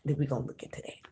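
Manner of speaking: hushed whisper. Real, not acted. Whispers the reading.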